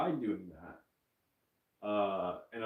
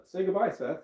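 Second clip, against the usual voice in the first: high, louder cue to the puppet; loud prompt; 8 semitones higher, 7 dB louder than usual. Acted. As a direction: call out to the dragon puppet. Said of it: "doing some really terrible ventriloquism".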